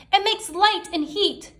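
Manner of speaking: a bold bird calling everyone together; Raven's voice. Acted. Raven tells the gathering about fire, high and bold. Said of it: "he said".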